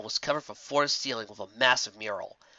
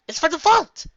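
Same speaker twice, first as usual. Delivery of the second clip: high, louder gasp of discovery; excited discovery; 9 dB louder than usual. A narrator's reading. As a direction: blurt it out thrilled. Twilight discovers the book.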